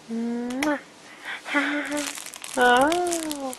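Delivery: ordinary delivery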